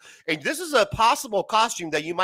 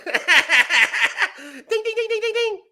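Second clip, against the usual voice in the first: ding ding sound; much slower than usual. Pretend. Correct answer bell call.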